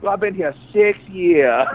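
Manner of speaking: high, slowed put-on voice; mock waitress. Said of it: "she looks back like".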